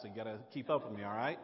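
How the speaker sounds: fast New York pace; talking really fast. Shown as a demonstration. Pastor warns the congregation to keep up, very fast speech. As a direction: rattle it off fast. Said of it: "talk really fast".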